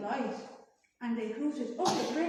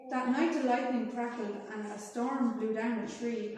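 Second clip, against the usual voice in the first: plain reading voice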